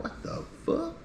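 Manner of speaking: breathless whispered disbelief; a whispered what-the-hell; stunned whisper. Retold or imitated. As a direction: mouth it in hushed shock. Reacting to ten roommates.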